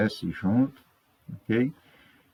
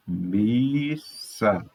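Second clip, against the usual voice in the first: MISSA split slowly, miis, sa; syllable by syllable. Shown as a demonstration. Teacher separating the double S in missa, syllable by syllable. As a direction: hold the first syllable of missa, then sa. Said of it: "separando as sílabas".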